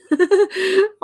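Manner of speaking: high, loud burst of laughter; real laugh. Real, not acted. Host laughs at a viewer's marriage proposal, delighted cackle.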